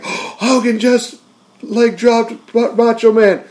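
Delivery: a gasp, then slow, high, stunned words; hammed-up disbelief, pitched high; mock gasp of shock. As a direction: play a fan who can't believe it.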